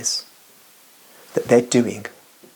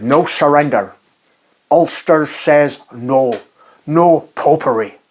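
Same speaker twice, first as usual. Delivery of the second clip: put-on loud, high Ulster accent, words stretched out; Ian Paisley Ulster accent; 4 semitones higher, 6 dB louder, slower than usual. Speaker regales viewers as a firebrand Protestant reverend, in Ulster accent. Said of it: "That's my best Ulster accent I can do".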